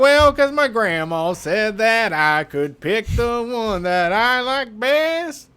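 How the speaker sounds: slow, high drawling country crooner; country singer voice. Retold or imitated. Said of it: "sounds like a goddamn country music song".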